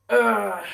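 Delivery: loud groan of dread; acted groan. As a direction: groan as if caught out. Acts panicked Deku's groan.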